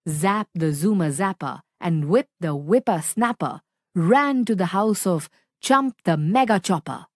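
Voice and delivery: ordinary delivery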